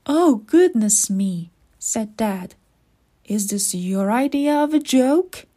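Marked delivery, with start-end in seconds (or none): 0.0-1.5 loud, startled exclamation; taken aback. A narrator's reading. Dad sees Keeper all gray, loud and taken aback. 3.2-5.5 loud, disbelieving question rising at the end; indignant disbelief. A narrator's reading. Dad questions the strange surprise, loud and indignant. Dad challenges the gray look.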